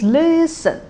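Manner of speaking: "listen" high and held, then low; high-low tone pattern. Shown as a demonstration. Teacher telling learners to use this tone, "listen" high then low. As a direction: stretch the high start of "listen", drop the end. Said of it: "the tone in this word".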